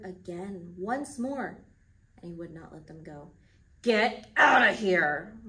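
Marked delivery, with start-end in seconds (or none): 3.8-5.4 loud, slow angry order; shouted. A narrator's reading. Pharaoh banishing Moses, slow loud shout. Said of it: "Pharaoh shouted at Moses".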